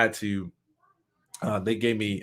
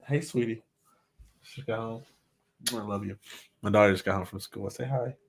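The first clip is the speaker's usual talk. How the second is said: tender aside to daughter; 5 dB quieter than usual. Real, not acted. Soft aside to his daughter.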